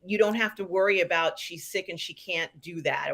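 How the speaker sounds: ordinary talk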